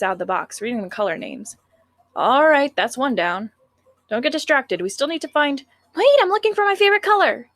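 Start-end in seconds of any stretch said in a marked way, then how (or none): 6.0-7.5 put-on high, whiny cute girl. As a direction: pout playfully like a sweet schoolgirl. Sayori's voice.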